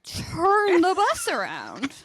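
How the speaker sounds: high, drawn-out imitation of passengers yelling; mock exasperated yell. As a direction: stretch it out like a whole busload pleading with the driver. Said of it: "everyone's like".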